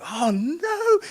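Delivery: high, loud cry of dismay; acted dismay. Passenger watching a man tumble from bus, dismayed cry. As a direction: wail it in mock horror. Narrator acts dismay at the jump.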